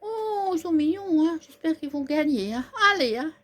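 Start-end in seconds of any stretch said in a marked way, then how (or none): none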